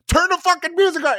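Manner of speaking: very high, loud frantic shout; high loud mock panic shout; frantic yell. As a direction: scream it in panic. Frantic demand.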